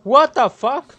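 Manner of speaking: high, loud startled yell; startled shout. Real, not acted. Gamer jolted by a jump scare, yelling.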